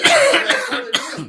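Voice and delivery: high, slow laughing repeat. Real, not acted. Friends joking at bar, laughing.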